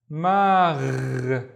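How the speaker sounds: "magh" ending in a long gargle; gargled gh. Shown as a demonstration. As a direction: draw out "magh" into a throaty gargle. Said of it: "the one with the gargling".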